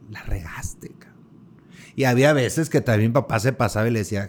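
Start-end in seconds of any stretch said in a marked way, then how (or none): 0.1-1.1 retold whisper, half under the breath; whispered aside. Worker whispering a cheeky jab at the boss. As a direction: let the insult slip out in a hush.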